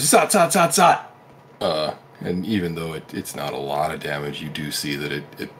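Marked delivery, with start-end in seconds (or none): 0.0-1.0 magic zap noises. Acted. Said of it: "so he goes".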